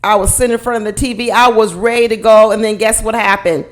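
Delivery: ordinary talk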